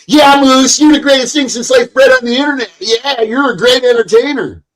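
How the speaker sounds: high, loud, fast sycophantic gushing; mock fawning. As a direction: suck up like a fanboy. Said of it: "But Murphy would be like".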